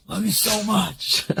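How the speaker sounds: a light parody of a harsh German love song; lightly voiced German crooner; mock German lover. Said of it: "something harsh in German".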